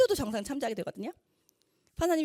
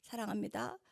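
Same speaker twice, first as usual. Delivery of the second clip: warm with some feeling; 2 semitones lower, 10 dB quieter than usual. Retold or imitated. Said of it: "감정이 들어간 따뜻한".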